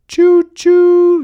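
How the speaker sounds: high, loud hooted train whistle; loud train choo-choo. A narrator's reading. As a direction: hoot like a toy train.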